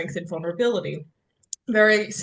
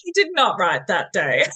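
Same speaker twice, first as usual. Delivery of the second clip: normal talking voice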